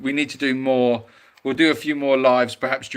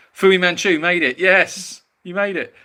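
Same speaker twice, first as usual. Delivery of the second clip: excited greeting; 6 semitones higher, 5 dB louder than usual. Real, not acted. Greeting a viewer who joined.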